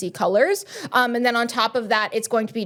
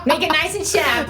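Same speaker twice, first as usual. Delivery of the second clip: Michaela impression; 4 semitones higher than usual. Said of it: "The Michaela accent is my favorite accent".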